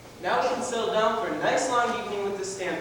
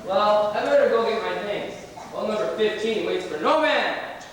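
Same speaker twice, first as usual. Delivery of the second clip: normal talking voice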